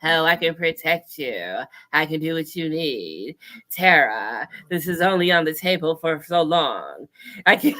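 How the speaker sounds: low, seductive creepy whisper-croon; sexy Crypt Keeper. Retold or imitated. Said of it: "a sexy, seductive Crypt Keeper".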